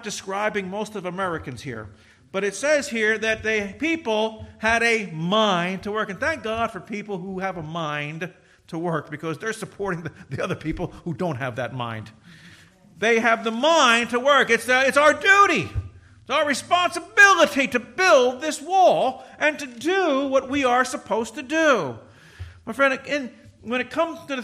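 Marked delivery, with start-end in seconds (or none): 2.3-6.1 slow and louder on had a mind to work; emphatic repeat. Shown as a demonstration. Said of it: "I want you to notice this last phrase". 13.0-22.0 high loud preacher's cry; fiery revival preaching; full-force preaching. Acted.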